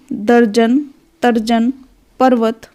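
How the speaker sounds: normal talking voice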